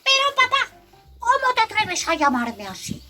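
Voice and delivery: high-pitched indignant little bunny; hammed-up outraged kid; very angry. A narrator's reading. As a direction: protest hotly in a high child voice. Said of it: "muy enfadado".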